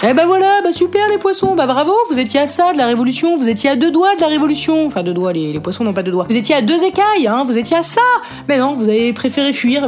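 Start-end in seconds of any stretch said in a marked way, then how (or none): 0.0-4.9 mock scolding. Scolding the fish in mock disappointment. 6.3-9.9 mock scolding. Scolding the fish.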